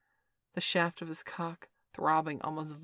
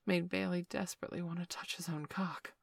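ordinary delivery

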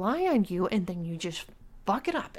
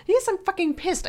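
loud, higher angry snap; furious outburst; 10 semitones higher, 7 dB louder than usual. Acted. Girlfriend confirming her anger to her boyfriend, loud. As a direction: spit the words out in rage.

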